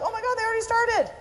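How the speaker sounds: high, fast startled exclamation; surprised gasp. Retold or imitated. Walking back in and finding the talk already started, high fast surprise. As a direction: blurt it out, caught off guard.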